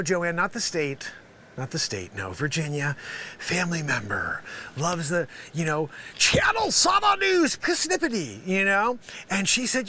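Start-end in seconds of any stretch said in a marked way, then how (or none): 6.2-8.5 high, called-out news slogan; high-pitched mock announcer; mock station ident. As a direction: belt out the station name like a jingle tag.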